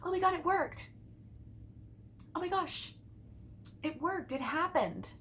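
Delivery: high-pitched mock amazement; excited exclamation. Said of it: "tempted to say stuff like".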